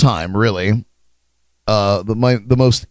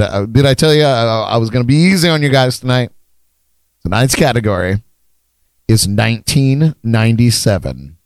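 ordinary delivery

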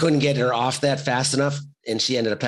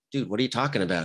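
ordinary delivery

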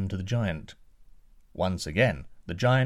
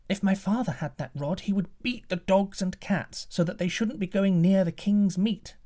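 put-on higher eager boy's voice; a cook's boy answering higher; 9 semitones higher than usual. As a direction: answer like an innocent young boy. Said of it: "The boy replied".

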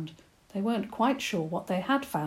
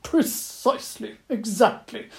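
loud, high barked praise; 5 semitones higher, 6 dB louder than usual. Acted. Badger barking out loud praise of Eva's idea. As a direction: bark it out, delighted. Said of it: "the badger gave a loud bark".

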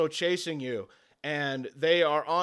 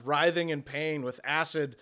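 ordinary delivery

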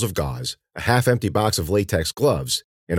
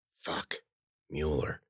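very soft, under the breath; muttered curse; 4 semitones lower, 13 dB quieter, slower than usual. Acted. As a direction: mutter it to yourself. Sees Mueller arrive, eyes closed.

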